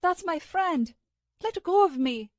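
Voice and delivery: high, loud, desperate shout; frantic shout. A narrator's reading. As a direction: yell to break free. Struggling against Eric's grip.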